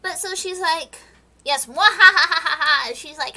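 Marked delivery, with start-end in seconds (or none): 1.4-3.0 slow, loud villain laugh; mock evil cackle, much louder; evil queen cackle. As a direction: ham up a wicked mwahaha.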